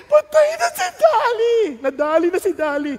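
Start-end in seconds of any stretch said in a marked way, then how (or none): 0.1-1.6 high, loud crying shout; children's loud, high crying wail. Retold or imitated. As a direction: wail that the lamb is dead. Children crying lamb is dead.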